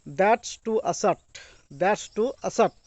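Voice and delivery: slow, spelled-out "that's to assert"; slow model phrase. Shown as a demonstration. Teacher drilling "that's to assert" slowly for students. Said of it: "teacher slowly models phrase".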